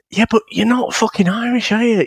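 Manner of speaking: high-pitched exasperated retort; pissed off. Retold or imitated. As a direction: challenge him as if talking back to the radio. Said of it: "what pisses me off".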